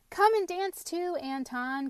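high, loud call across the dance. A narrator's reading. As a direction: call him over to dance. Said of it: "called Babette".

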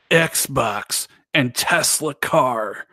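slow, booming fighting game announcer. Pretend. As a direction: drag each word out like an arena announcer. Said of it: "our announcer voice".

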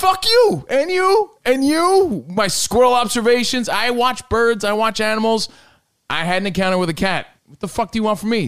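mock angry rant. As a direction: rant at everyone.

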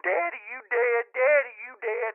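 put-on high whiny crying kid voice; crying little boy. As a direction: imitate a sobbing scared boy.

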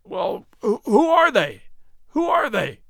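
high, alarmed questioning; quick, urgent asking. A narrator's reading. Man strains to see what his companion saw, urgent asking. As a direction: stumble into it, then demand it urgently. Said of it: "asked George quickly".

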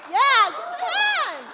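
loud and high, rallying applause; calling out to crowd. Real, not acted. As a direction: call the room to clap. Host rallies crowd for applause.

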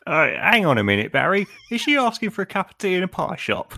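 lightly voiced busybody; mock English onlooker. Improv onlooker in scene.

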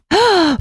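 high, showy gasp; theatrical gasp. Acted. Reacting to a shared celebrity birthday, showy gasp. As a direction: gasp in delighted mock awe. Mock thrill at birthday news.

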